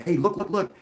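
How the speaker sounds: high, eager look look look; urgent pointing. Retold or imitated. Dan pointing the UFO out to neighbors, high and eager. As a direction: grab the neighbors' attention. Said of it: "And Dan's like".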